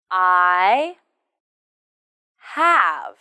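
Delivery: "I" and "have" drawn out, wide gap between; slow model. Shown as a demonstration. Teacher says listen to the answer pattern, slow drill. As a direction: stretch each word alone.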